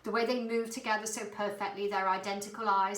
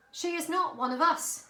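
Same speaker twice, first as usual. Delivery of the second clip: insisting; 9 semitones higher, 6 dB louder than usual. A narrator's reading. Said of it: "insisted Father".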